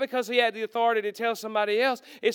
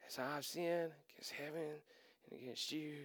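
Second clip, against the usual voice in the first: much softer and lower, muttering the rehearsed confession to himself; rehearsing under his breath; 5 semitones lower, 19 dB quieter than usual. Retold or imitated. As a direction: practise the line quietly, as if nobody should hear. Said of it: "let me go over this one more time".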